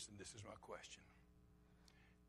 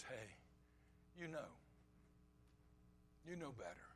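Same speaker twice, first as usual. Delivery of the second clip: soft, low prompting of conscience; gentle nudge; 4 semitones lower, 15 dB quieter than usual. Acted. Preacher voices the Holy Spirit convicting a heart, gently hushed. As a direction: say it softly, with pauses to let it land.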